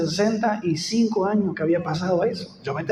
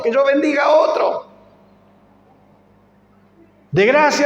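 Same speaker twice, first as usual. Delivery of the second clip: louder, higher fervent preaching; 5 semitones higher, 4 dB louder than usual. Acted. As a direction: build the appeal to the congregation. Preacher rising to full force.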